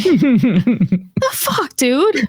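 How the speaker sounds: outraged at being interrupted mid-cry; mock indignant. Wife scolding her husband for interrupting her cry, mock indignant. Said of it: "And I'm like".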